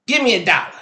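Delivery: lightly acted kid making a demand. Five-year-old demands money from mom's boyfriend, lightly voiced demand.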